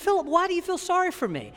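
high-pitched, incredulous mock protest; incredulous retort. Host pastor answers visiting pastor's pity, mock incredulous. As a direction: question it in high disbelief, playing it up.

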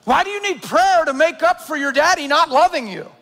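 ordinary talk, nothing marked

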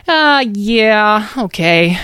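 hesitant small creature. A narrator's reading. Mouse gives in to the threat, hesitant. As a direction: agree reluctantly in a creature voice. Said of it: "responded the voice".

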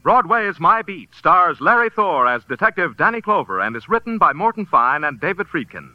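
ordinary talk, nothing marked

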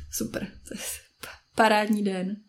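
whispered sarcastic aside; sarcastic muttering. Acted. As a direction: mutter bitterly under the breath, almost voiceless. Muttered sarcasm after unhelpful friend. (sarkasticky si zamumlat)

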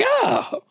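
high, hammed-up "oh" of disgust; mock-disgusted high moan; disgusted groan. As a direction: imitate an appalled mentor's exclamation. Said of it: "so disgusted".

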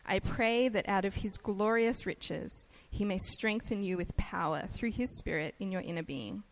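ordinary talk, nothing marked

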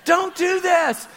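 high, drawn-out pleading call; mock calling after her. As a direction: call out as if she's drifting away. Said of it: "And we're like".

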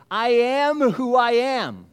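slow, high, loud and grand; God's solemn voice. Acted. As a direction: proclaim it slowly like God naming himself. God declares His name.

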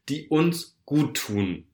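ordinary talk, nothing marked

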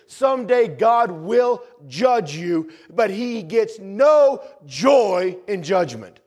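high, slow, pressing preaching; earnest preaching. Acted. Preacher warning the congregation, slow and pleading. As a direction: plead slowly about judgment.

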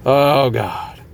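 low, weary mock groan at his wife; exasperated groan. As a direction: sigh it out like a scolded husband. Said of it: "and I'm like".

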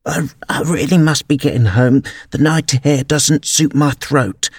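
put-on old magpie. A narrator's reading. Old magpie excusing herself from party, fussy voice. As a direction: play a fussy elderly bird. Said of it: "One old magpie".

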